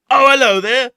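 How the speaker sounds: very high, loud character voice; a sudden squeaky hello in character; loud high hello to the Governor; put-on greeting.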